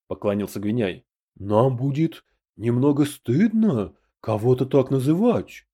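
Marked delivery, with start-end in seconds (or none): none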